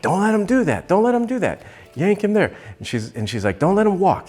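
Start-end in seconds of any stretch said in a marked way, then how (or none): 0.0-2.5 urgent yelling. Retold or imitated. Sister shouting at rider to stop horse. Amy yelling riding orders. 3.6-4.3 high insistent order; urgent command. Retold or imitated. Sister ordering her not to let horse walk.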